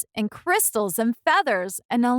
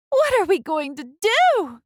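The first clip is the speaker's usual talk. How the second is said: distressed cry; 9 semitones higher than usual. Acted. Missus Cream crying out over her daughter, high and distressed. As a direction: cry out in worry. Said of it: "cried Missus Cream".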